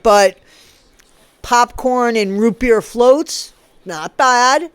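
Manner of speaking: normal talking voice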